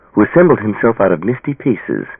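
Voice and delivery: usual speaking voice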